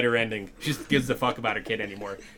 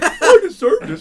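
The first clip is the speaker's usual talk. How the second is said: very high wailing cry, laughing through it; hammed-up sob of a stabbed man; mock crying; 14 dB louder, slower than usual. Doug whimpers after his hand is stabbed, mock crying. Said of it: "He stabs Doug's hand".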